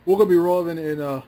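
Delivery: lightly British pilot announcement over the intercom; a hint of a British accent in the pilot announcement; mock British pilot. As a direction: play a British captain announcing arrival. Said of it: "now that you say it as a British person".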